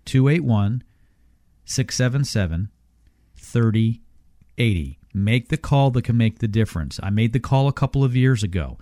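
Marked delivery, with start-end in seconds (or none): 0.1-5.0 slow, deliberate number read; slowly. Shown as a demonstration. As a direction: space out each number carefully. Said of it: "One more time slowly".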